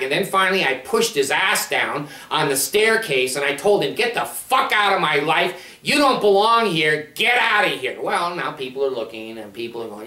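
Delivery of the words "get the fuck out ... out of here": raised, forceful retold yell; angry order to get out. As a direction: throw him out with a shout. Shoving trespasser off property.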